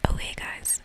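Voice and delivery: ordinary talk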